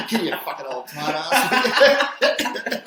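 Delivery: laughing. Real, not acted. Laughing jibe at stingy host.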